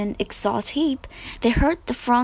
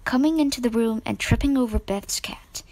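ordinary delivery, nothing marked

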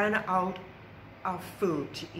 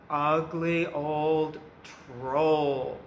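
menacing troll intro; 3 semitones lower, much slower than usual. A narrator's reading. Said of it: "introducing the troll".

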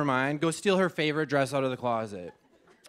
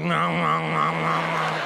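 slow mock refusal; much slower than usual. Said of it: "paper towels are like".

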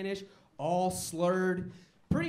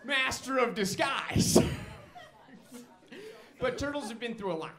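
high, loud silly turtle puppet voice; Turdy the turtle; 4 semitones higher, 3 dB louder than usual. Acted. Turtle guest addresses the comedy audience, high silly voice. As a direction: play a goofy, dazed turtle guest. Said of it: "put your hands together for Turdy".

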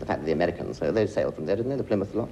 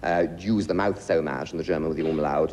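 ordinary talk

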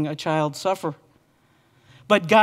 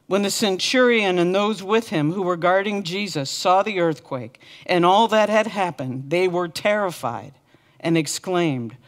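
usual speaking voice

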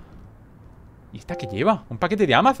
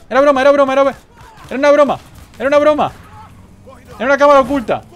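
high, loud hammed-up excuses; mock pleading yell; 11 semitones higher, 7 dB louder, much faster than usual. Player to his game victim, mock pleading shouts. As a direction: call out mock apologies in a high voice.

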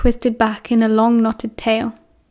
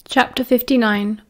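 ordinary delivery

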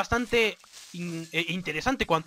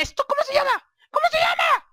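much higher, shouting the question; frustrated shout; 4 dB louder than usual. Real, not acted. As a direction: yell it out in frustration. Forgetting the word.

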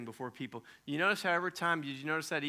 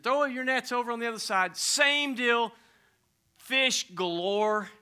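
loud, high excited build; excited telling; 10 semitones higher, 10 dB louder than usual. A narrator's reading. Preacher telling congregation about the catch, excited and loud.

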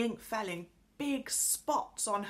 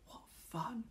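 soft, breath-only exclamation of delight; delighted whisper; 4 semitones higher, 11 dB quieter than usual. A narrator's reading. Girl peeping secretly through a window, delighted whisper.

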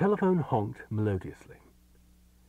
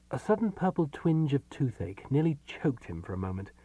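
ordinary delivery

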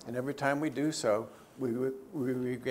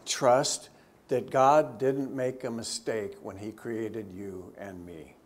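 ordinary talk

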